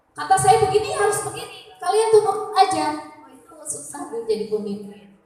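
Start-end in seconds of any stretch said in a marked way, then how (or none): 0.1-3.0 higher, louder, imitating a domineering boss; mock a leader who won't be argued with; bossy leader. Preacher imitating a stubborn leader giving orders, mock bossy.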